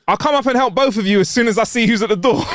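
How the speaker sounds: mock yell. A narrator's reading. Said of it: "Then he yells".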